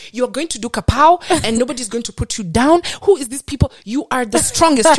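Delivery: hyped-up friend impression. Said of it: "She's like".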